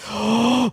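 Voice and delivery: sharp surprised gasp. Real, not acted. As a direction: suck in air in surprise. Surprised gasp before name.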